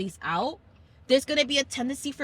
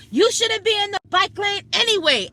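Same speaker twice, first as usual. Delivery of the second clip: higher, louder, scolding; angry retort; 10 semitones higher, 8 dB louder than usual. Retold or imitated. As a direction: snap back at the family. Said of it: "He's like".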